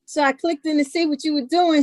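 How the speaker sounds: ordinary talk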